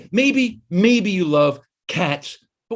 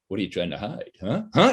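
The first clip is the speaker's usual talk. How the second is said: suspicious; 6 semitones lower than usual. Retold or imitated. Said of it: "And then I think".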